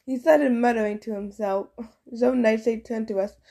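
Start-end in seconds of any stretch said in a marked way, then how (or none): none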